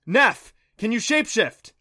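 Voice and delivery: loud, high call for help; urgent shout. Acted. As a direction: shout it to the mount mid-chase. Shouted plea during the chase.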